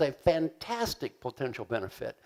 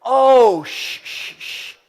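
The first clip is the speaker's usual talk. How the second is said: mock hush; 9 semitones higher, 12 dB louder, much slower than usual. Mocking the excuse.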